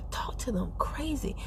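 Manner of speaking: hushed aside to viewers; whisper. Real, not acted. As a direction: let it fall to a hushed whisper. Drops to a whisper mid-point.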